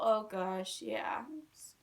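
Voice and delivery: ordinary delivery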